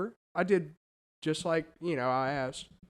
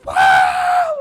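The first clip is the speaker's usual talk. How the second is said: put-on very high, loud drunken-crowd shriek; imitated scream; 11 dB louder than usual. Imitating screaming woman at the concert, piercing yell. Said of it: "one of them is screaming".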